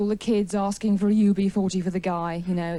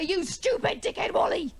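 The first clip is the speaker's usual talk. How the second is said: angry insult; 8 semitones higher than usual. Acted. Shopper grabbing a man by the scruff, angry insult. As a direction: hurl it like an exasperated attacker. Acted attack on the workman.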